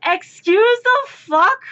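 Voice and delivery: high, slow mock outrage; imitating a stunned girlfriend; high disbelieving excuse the fuck; incredulous retort. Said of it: "Alex ... goes".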